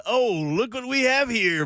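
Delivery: high, slow, teasing gotcha; look-what-we-have-here voice. Acted. Co-host names his catch-someone-out voice, high teasing drawl. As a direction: stretch it out, mock-impressed.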